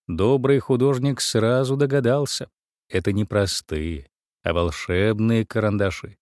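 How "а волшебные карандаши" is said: ordinary delivery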